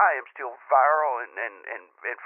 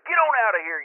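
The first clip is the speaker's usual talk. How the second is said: mock yell; 8 semitones higher, 4 dB louder than usual. Yelling at the squatch.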